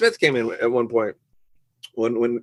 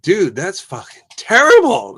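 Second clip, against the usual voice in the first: loud, high, drawn-out mock outrage; over-the-top condemnation; 7 semitones higher, 8 dB louder, slower than usual. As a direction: slam it with exaggerated disgust. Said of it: "It's not like".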